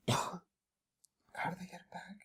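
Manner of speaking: breathy whispered aside. Real, not acted. Recapping the plot, whispering a question to himself. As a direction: whisper it to yourself, slowly.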